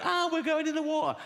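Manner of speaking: high-pitched panicked cry; mock panic. Passengers running around as the boat hits the iceberg, mock panic. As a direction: squeal it like a mock-terrified passenger.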